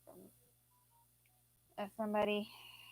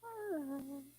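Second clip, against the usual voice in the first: real sleepy yawn; 5 semitones higher than usual. Real, not acted. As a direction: let a yawn slip out mid-sentence. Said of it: "Had a yawn".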